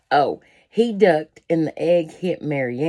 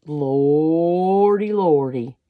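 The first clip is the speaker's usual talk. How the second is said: louder, stretched lordy lordy in dismay; loud drawn-out exclamation; 7 dB louder, much slower than usual. Real, not acted. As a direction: cry out lordy lordy at the sight.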